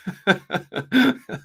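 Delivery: high burst of laughter; helpless laugh at a grim subject. Real, not acted. Commentator amused by his own acted accusation, laughing. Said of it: "I'm laughing".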